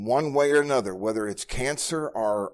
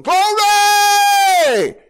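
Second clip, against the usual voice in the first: huge, high, loud shout of "Glory"; joyful shout; 14 dB louder than usual. Retold or imitated. Pastor imitates his daughter shouting from the back of the church, huge and high. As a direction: cry it out across the church. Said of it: "she's back there going".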